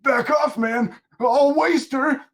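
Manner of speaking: high, loud panicked threat; threatening shout. Acted. Mutant gripping Robin warns Batman off, threatening shout. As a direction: shout it as a cornered thug.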